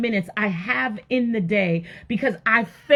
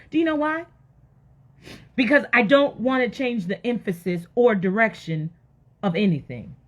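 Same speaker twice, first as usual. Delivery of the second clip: ordinary talk, nothing marked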